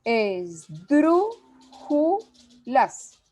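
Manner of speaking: "Esdrújulas" drawn out slowly as es-DRÚ-ju-las; very slow "Esdrújulas", es, DRÚ, ju, las, with pauses; syllable by syllable. Shown as a demonstration. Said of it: "es ejemplo".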